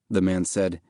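ordinary delivery, nothing marked

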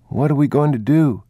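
put-on a small boy fretting aloud; boy's voice. A narrator's reading. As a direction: ask it as an anxious child.

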